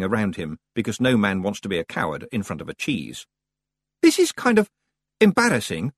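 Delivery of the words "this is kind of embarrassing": put-on high, loud awkward boy voice; embarrassed lad. Roland hesitates before kissing the sleeper, embarrassed. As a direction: squirm with embarrassment.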